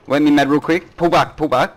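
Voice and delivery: high loud urgent shout; shouted callout. Real, not acted. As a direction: yell the retreat.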